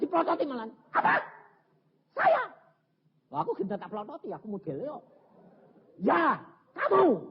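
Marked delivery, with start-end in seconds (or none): 0.9-2.4 high, loud shocked retort; indignant surprise. Retold or imitated. Husband startled at being blamed, high loud retort. 6.0-7.2 slow, loud pointed "kamu"; slow accusation. Retold or imitated. Preacher accusing the husband, slow loud emphasis.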